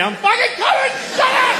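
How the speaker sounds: very high-pitched frantic shout; exasperated yell. Retold or imitated. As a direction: yell at a phone buried in your pocket. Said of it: "I'm yelling into my pocket".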